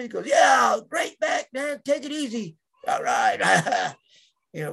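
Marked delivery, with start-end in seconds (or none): 0.3-2.6 high, hollered on-air sign-off; Puppet. Retold or imitated. Boss signing off a radio interview, high hyped holler. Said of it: "it was just like night and day". 2.9-3.9 big drawn-out showman sign-off; Puppet. Retold or imitated. Boss still live on air, hyped-up sign-off. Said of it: "it was just like night and day".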